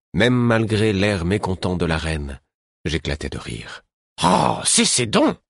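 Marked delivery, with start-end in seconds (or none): 4.2-5.4 slow, exasperated scolding; weary old woman telling them off; discouraged reprimand. A narrator's reading. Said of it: "un tel découragement dans la voix".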